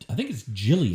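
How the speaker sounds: "Gillian" with a soft G, like "Jillian"; soft-G pronunciation. Shown as a demonstration. Answering how the surname is said, "Gillian" as "Jillian". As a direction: say "Gillian" starting with a J sound. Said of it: "how you pronounce her last name".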